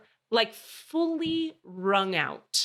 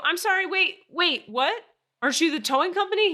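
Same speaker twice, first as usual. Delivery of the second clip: high, loud acted disbelief; incredulous; 10 semitones higher, 5 dB louder than usual. Stranded driver stopping the tow guy, retold incredulity. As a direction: call him back, baffled and insistent.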